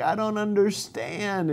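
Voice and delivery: a hint of Mary's weeping, said softly. Mary at the tomb, crying to herself, quiet and broken. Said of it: "and she's weeping".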